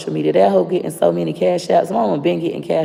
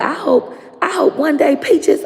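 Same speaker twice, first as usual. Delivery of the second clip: high, slow grandma curse; imitating grandma; 10 semitones higher than usual. Granddaughter imitates grandma's curse, high, slow. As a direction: mimic her cursing, pitched high. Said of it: "She said".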